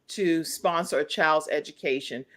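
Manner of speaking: ordinary talk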